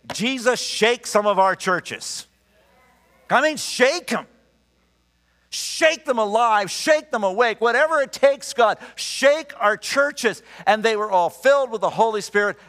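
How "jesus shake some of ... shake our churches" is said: high, urgent pleading prayer; fervent prayer. Real, not acted. Preacher pleading with God for the churches, fervent and raised. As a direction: cry out to God to shake the churches.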